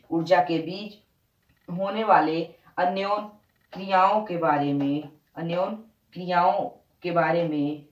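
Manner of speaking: slow, word by word, so pupils can write it down; slow dictation. Shown as a demonstration. Teacher dictating the physics definition to the class, slow and deliberate. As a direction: spell the definition out slowly, pausing between phrases. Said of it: "अब हम विज्ञान ऐसे पढ़ेंगे".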